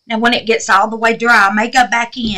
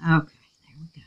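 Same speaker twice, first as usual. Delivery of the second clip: whisper; 6 semitones lower, 7 dB quieter, much faster than usual. Real, not acted. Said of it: "Y'all, I'm quiet, ain't I?".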